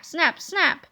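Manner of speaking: ordinary delivery, nothing marked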